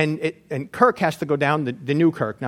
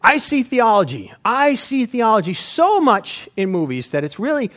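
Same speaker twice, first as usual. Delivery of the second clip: ordinary delivery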